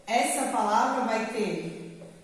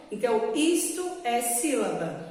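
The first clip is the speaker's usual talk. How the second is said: ordinary talk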